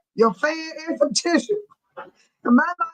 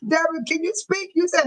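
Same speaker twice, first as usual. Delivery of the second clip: ordinary talk, nothing marked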